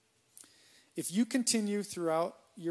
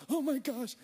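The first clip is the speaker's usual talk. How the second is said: high, frightened gasp of a man on shaky scaffolding; mock panic; 8 semitones higher than usual. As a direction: squeak it out like you're terrified of heights. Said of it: "I'm shaking".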